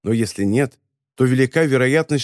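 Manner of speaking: plain reading voice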